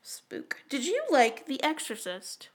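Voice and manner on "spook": breathy hushed word; whispered spook. Real, not acted. Spooky aside to cohost, whispered. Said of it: "whispered one-word aside".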